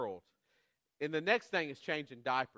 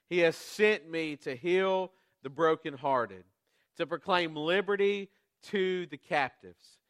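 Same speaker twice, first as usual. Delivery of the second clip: scripture reading; 4 dB louder than usual. Acted. Preacher reading Isaiah aloud to the congregation, solemn and ringing. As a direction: read the passage out with weight.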